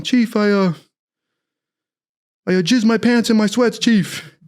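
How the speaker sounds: high-pitched recruit reporting to his chief; mock awkward report; sheepish confession.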